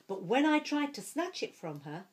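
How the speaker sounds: usual speaking voice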